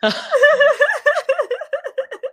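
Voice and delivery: squealing high laughter. Real, not acted. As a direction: laugh hard. Laughing at mistake.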